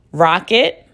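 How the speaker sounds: usual speaking voice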